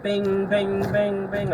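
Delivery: high bing bing sounds; imitated pump beeping. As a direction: mimic the gas pump chiming.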